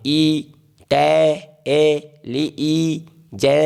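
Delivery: "ई, टे, ए, ली, ई, जे" with each vowel held long; slow, sustained sounding-out of "ई, टे, ए, ली, ई, जे"; drawn-out vowel sounds. Shown as a demonstration. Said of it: "जहाँ पर vowel sound आ गया".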